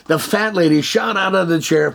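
usual speaking voice

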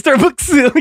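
put-on high, amused Chinese-accented "Starbucks" with an added final vowel. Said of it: "how do they say it".

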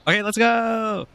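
excited. Acted. Cheerful departure.

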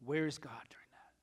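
a hushed, fast whisper. Real, not acted. Pastor whispering a hard question to the congregation.

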